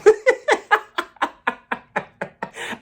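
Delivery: high burst of real laughter; cracking up high. Real, not acted. Laughing at Mary clip.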